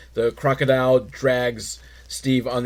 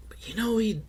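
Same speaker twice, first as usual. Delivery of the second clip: soft secretive whisper; hushed whisper; 7 semitones higher, 9 dB quieter than usual. Real, not acted. Conspiratorial aside about Steve.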